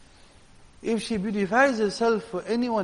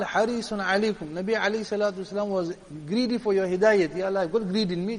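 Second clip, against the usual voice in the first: ordinary talk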